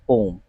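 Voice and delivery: "पोम" in one syllable, POHM; no "yum" in "पोम", just one long o; claimed correct pronunciation. Shown as a demonstration. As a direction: say "पोम" as pohm, rhyming with home. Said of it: "इसका जो सही उच्चारण है".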